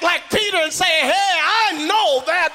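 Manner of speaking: climactic sermon whoop. Acted. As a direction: lift the church up with a chanted whoop. Preacher building to whooping climax.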